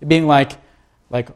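ordinary delivery

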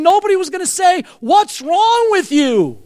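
high-pitched, building cry; exuberant outburst. Retold or imitated. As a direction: build up high and stretch the last words. Acts out shouting out in dump.

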